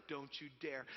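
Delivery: low warning, lightly acted; imitated wife's warning. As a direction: warn your husband under control. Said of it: "Kayla's like".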